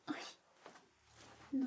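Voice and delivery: ordinary delivery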